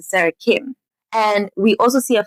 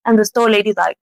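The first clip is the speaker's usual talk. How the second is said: ordinary delivery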